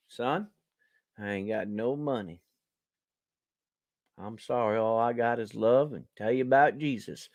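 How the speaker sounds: voiced low and soft, like a broke street preacher; soft, low sing-song of a man asking for sympathy. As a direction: drop down low and mournful for the preacher's plea. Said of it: "he goes".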